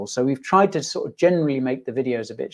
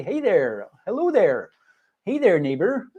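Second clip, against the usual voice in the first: lilting Fargo-style Upper Midwest greeting, pitched up; North Dakota Fargo accent; 6 semitones higher, 3 dB louder than usual. Retold or imitated. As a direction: do a sing-song North Dakota hello. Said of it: "They all say".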